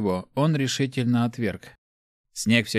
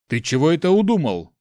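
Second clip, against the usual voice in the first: higher, louder questioning; mildly startled challenge; taken aback; 5 semitones higher, 5 dB louder than usual. A narrator's reading. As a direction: ask it with rising disbelief. Startled friend confronts Mart.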